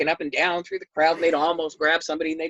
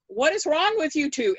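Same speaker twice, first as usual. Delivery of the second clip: baffled demand; 8 semitones higher than usual. Retold or imitated. Baffled man confronting screamers.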